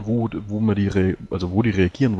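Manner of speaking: normal talking voice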